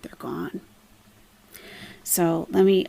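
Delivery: usual speaking voice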